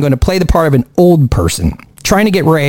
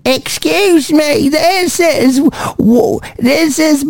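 put-on slow, higher, creaky old man; 7 semitones higher, much slower than usual. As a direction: drag it out like a frail old man. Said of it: "I could go so old".

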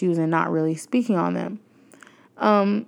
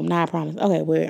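usual speaking voice

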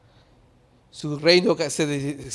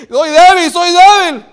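high, full-voiced acted cry; shouted confession; 13 semitones higher, 17 dB louder than usual. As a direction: shout the admission out loud. Acts Paul's confession at full force. (confesión a gritos, con voz aguda)

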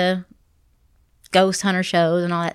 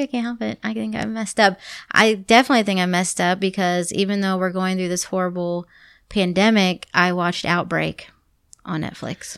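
ordinary talk

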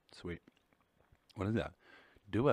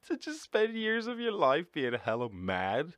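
higher, slower, laughing through the words; 10 semitones higher, 3 dB louder than usual. Real, not acted. Streamer mocking people who stay mad for years, laughing. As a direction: laugh at how absurd it is.

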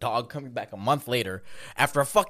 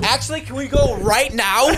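laughing; 10 semitones higher, 8 dB louder than usual. Pretend. Mock eager waxing request, laughing.

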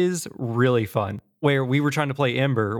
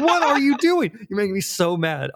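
mock angry outburst; 8 semitones higher than usual. Gamer yelling at co-op partner who's looting, mock furious. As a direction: blow up at him like you're frothing with anger. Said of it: "frothing with anger".

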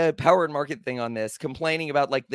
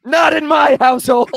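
strict parent yelling; 10 semitones higher, 10 dB louder than usual. Acted.

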